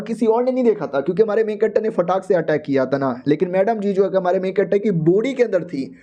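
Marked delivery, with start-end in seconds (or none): none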